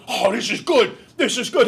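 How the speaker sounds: loud, high, thrilled barflies; hammed-up delighted exclaiming; mock excitement. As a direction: gush loudly as the hooked listeners. Voices the thrilled listeners in story.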